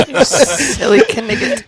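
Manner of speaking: slow, high mock French taunt; a jeering Frenchman, slow; French accent. Mock Monty Python taunt.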